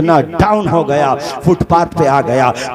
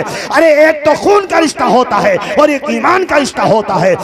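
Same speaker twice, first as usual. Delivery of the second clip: higher, ringing exhortation; forceful preaching; 5 semitones higher than usual. Acted. Preacher urges the villagers to end their feuds, in a forceful call.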